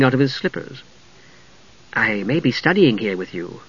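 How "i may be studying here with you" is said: put-on soft, coy young woman; a little hushed and alluring; Usher. A narrator's reading. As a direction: Usher charming the young mage, soft and sweet. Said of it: "Usher continued".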